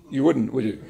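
teasing through real laughter; laughing. Real, not acted. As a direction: chuckle the words out, self-mocking. Teasing herself about words.

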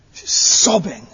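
ordinary talk

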